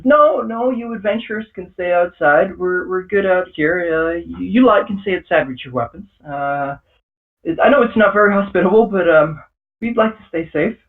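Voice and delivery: nervous father backing away from armed adventurers; frightened father. Acted. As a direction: shrink back and beg them to stay outside. GM voices frightened father shrinking back.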